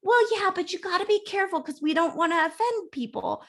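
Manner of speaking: high, quick mock-cautious voice; church lady. Church ladies warning Steve not to offend, in a high mock voice. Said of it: "And they're like".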